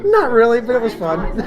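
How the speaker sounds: giggly, amused reply; laughing brother. Retold or imitated. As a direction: answer like a kid who enjoyed the fight. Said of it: "she goes".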